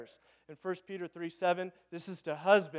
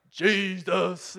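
loud, exaggerated, drawn-out mimicry; mock the preachy way of saying Jesus; mocking imitation; 5 dB louder than usual. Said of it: "Why do you say it like that".

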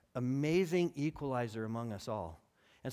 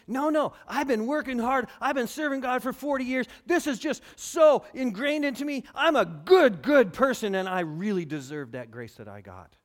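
higher and louder, puffed-up pride; self-righteous boast; 7 semitones higher, 10 dB louder than usual. Retold or imitated. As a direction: brag about your own goodness. Voicing a self-righteous believer.

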